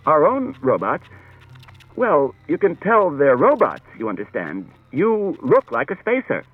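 agitated. A narrator's reading. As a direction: press the question nervously. Bailey flustered.

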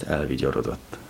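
ordinary delivery, nothing marked

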